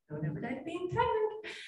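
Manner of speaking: put-on high-pitched little old Scottish lady. Doctor recalling an adorable old Scottish woman's advice, old lady Scottish voice. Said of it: "I said it in this old lady Scottish voice".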